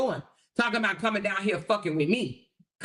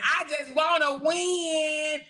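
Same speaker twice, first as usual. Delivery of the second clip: mocking drawn-out read; 5 semitones higher, much slower than usual. Rereading a rival's username aloud, mocking it slowly. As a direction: sneer the quote back at her slowly. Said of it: "Oh, look at the name".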